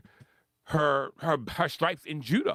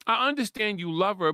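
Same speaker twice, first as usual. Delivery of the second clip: normal talking voice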